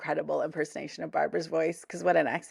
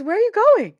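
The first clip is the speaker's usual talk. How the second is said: mock alarmed call; 13 semitones higher, 8 dB louder, faster than usual. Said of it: "and she goes".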